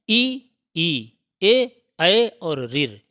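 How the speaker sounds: very slow, each vowel stretched; teacher dictating the front vowels slowly to the class; drawn out. Shown as a demonstration. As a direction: spell the vowels out one by one, slowly. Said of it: "ये अग्रस्वर कहे जाते हैं".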